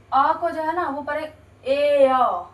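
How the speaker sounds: "ए अ" said slowly as ay then uh; ay-uh glide. Shown as a demonstration. Teacher slowly modelling the ay-uh sound. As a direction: split the vowel into ay, uh. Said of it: "ऐसे बोलेंगे".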